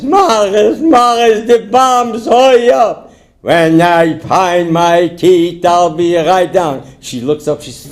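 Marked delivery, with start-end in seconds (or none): 0.0-3.1 high, frantic cry up the stairs; hammed-up terror, pitched way up; panicked calling. Wife calls as bombs fall. 3.4-6.8 very slow, toothless reply; higher, gummy insistence; toothless husband. Acted. Toothless man answers last time.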